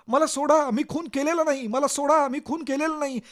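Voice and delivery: high, loud desperate pleading; frantic shouting. Acted. Accused man begging the crowd to let go, frantic shouting. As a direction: scream it over and over like a madman. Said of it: "हेच तुम्ही वेड्यासारखे ओरडत राहता".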